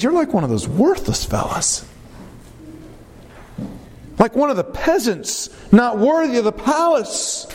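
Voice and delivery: scornful wife, punching the insults; contemptuous sarcasm. Acted. Wife belittling David as one of the peasants, contemptuous. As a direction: belittle him with mock disdain. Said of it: "the sarcasm in her voice".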